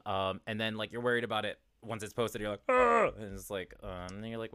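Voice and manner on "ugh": high, loud mock groan of dread; loud disgusted groan. Podcaster cringing at their posted episode, loud mock groan.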